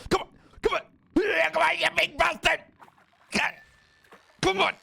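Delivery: high, loud yelling at the fish; excited shout. Real, not acted. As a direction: scream it on, full force. Shouting at hooked big fish.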